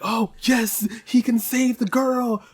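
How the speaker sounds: high, slow dreamy gushing; mock hopeful gush. Telling viewers how fans hoped, in a high slow gush. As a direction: gush it like a naive hopeful fan. Mocking hopeful fan take.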